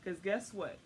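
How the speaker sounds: ordinary talk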